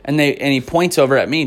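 usual speaking voice

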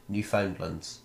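"Newfoundlands" with a clear "found" in the middle; full "found" pronunciation. Shown as a demonstration. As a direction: say "Newfoundlands" as new-FOUND-lunds. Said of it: "people call Newfoundlands".

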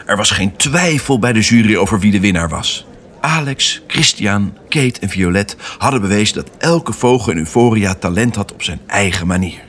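usual speaking voice

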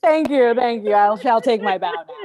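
loud, theatrical thank-you sinking in pitch; grand mock thanks. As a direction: take a showy bow with your voice. Bowing after praise for Spanish.

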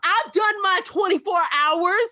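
high, cocky taunt with laughter; mock tough-girl taunt. To the cop, a cocky mock taunt. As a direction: pick a fight, brashly. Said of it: "I was just like".

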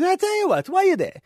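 put-on loud, high-pitched hammy Italian accent; Italian guy. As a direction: bellow it as an excitable Italian customer. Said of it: "And then the Italian goes".

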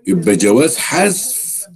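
usual speaking voice

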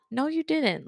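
disbelieving reaction. Acted. Listener reacting to an unbelievable story, mock disbelief. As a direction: act out doubting what you just heard.

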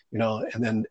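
ordinary talk

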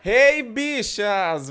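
loud, high, slow drawn-out greeting; campy drag queen. Acted. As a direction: belt out the opening catchphrase. Podcast opening catchphrase shouted. (drag queen saudando alto e arrastado)

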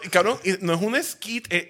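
ordinary delivery

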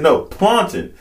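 high, firm "No, plantain" as plan-tn; the server insisting on "plantain"; mock server correcting, "plantain" clipped. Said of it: "she said".

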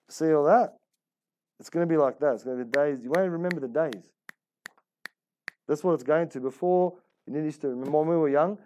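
normal talking voice

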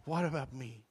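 soft, dismissive echo of the line; quiet scornful mimic; mocking the song. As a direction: quote it back with disdain. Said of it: "I hate that song".

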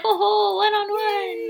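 high, thrilled mock squeal; excited squeal. Ben reacting to his one-on-one date card, thrilled high squeal. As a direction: gush like you just got the date.

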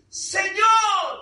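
Señor called out high and loud; high ringing Señor. Shown as a demonstration. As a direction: proclaim Señor with full voice. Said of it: "número dos".

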